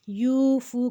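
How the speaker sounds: یوفو with both oo vowels held equally long; equal stretch. Shown as a demonstration. Teacher points out the syllables to stretch, long even یوفو. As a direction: draw out "yoo" and "foo" evenly in یوفو. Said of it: "کو برابر کھینچیں گے".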